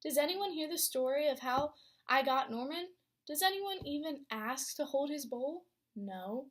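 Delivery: usual speaking voice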